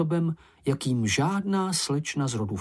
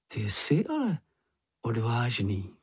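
ordinary delivery, nothing marked

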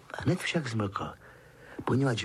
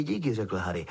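ordinary delivery

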